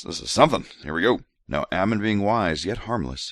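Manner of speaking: usual speaking voice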